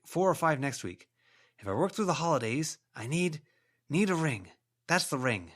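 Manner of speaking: a desperate man clinging to one thought; obsessed ghost. A narrator's reading. Ghost insisting to Verona the ring is worth it, desperate.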